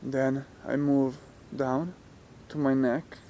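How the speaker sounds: soft, unhurried meditation voice with long pauses; guided meditation. Real, not acted. Teacher talking listeners through relaxing the muscles, guided meditation. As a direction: guide them gently, leaving space after each phrase.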